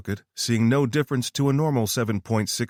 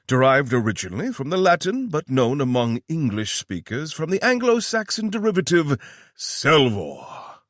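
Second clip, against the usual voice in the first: higher, louder pompous British lecture; lecturing fake British; 7 semitones higher, 4 dB louder than usual. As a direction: orate like a stuffy Oxford don. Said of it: "The British accent returned, and the volume went up considerably".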